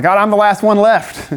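a hint of despairing prophet, half laughing; Elijah crying out. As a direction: Elijah on the run pleading with God, mildly hammed up. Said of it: "he cries out to God. He's like".

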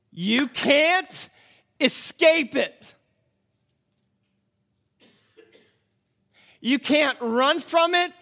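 high, loud, slow hammered warnings; preaching at full force. Acted. Preacher warns the congregation there's no escape, full-force shout. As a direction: shout each phrase at the congregation.

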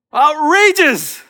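high, drawn-out cry of scandal; stretched, hammed-up indignation; mock outrage. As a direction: exclaim it loudly like a scandalized onlooker. Voicing the crowd's shocked grumbling.

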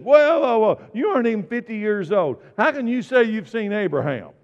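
loud, high-pitched disbelieving objection; incredulous crowd. Acted. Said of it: "And the people said".

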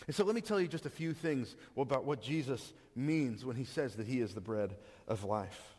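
ordinary talk, nothing marked

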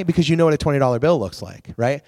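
usual speaking voice

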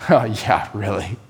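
low, soft and sure; a softly certain lover; tender reassurance. Acted. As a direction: reassure her gently. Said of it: "and answers".

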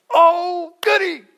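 squealing high, loud glee; hammed-up giddy delight; mock overjoyed. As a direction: gush like a giddy child.

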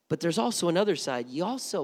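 ordinary talk, nothing marked

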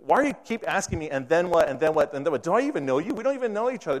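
mock-frustrated student losing patience. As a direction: push back at a stranger who keeps pestering. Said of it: "He's, like, frustrated".

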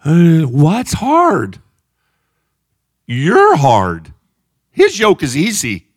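loud, very slow mocking challenge; scolding preacher. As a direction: throw the excuse back at them. Preaching at full force.

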